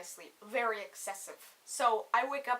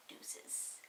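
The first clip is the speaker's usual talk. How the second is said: hushed, toneless farewell; whispered sign-off; 18 dB quieter than usual. Retold or imitated. Date at the door before leaving, whispered farewell. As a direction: breathe it out as a tiny parting word. His parting word at the door.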